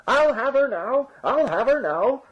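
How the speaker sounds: high, slow, drawn-out cry; bawling. A narrator's reading. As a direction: wail it out from inside a sack. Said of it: "bawled the farmer from inside the sack".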